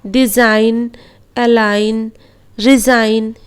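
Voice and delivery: डिजाइन, अलाइन, रिजाइन with g unsounded; silent g. Shown as a demonstration. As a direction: read each word slowly, no g. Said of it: "इस तरह पढ़ेंगे". (जी silent रखकर पढ़ें)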